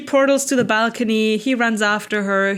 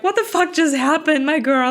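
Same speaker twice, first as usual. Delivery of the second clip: higher, lightly voiced shocked friend; a hint of a stunned best friend; retelling a character gasping at what happened; mock shocked friend; 7 semitones higher than usual. Retold friend's shocked reaction.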